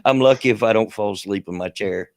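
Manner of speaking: ordinary talk, nothing marked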